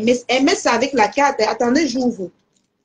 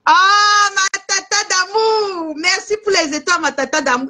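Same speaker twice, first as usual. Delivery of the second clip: delighted gratitude; 4 semitones higher than usual. Real, not acted. Live seller thanking a viewer for stars, delighted gushing. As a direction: gush with delight, stretching the words.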